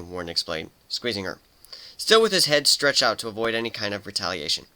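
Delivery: ordinary delivery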